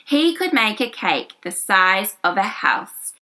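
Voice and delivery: usual speaking voice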